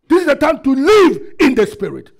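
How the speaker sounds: forceful pulpit declaration, short of a scream; preached declaration. Shown as a demonstration. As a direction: proclaim it firmly for them to repeat. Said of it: "You are not saying as if you mean it".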